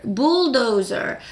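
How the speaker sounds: bulldozer very slow with a curled r sound; rolled bulldozer. Shown as a demonstration. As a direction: roll through bulldozer slowly. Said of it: "거의 다 굴려요".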